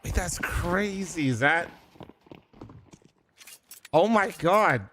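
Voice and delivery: ordinary delivery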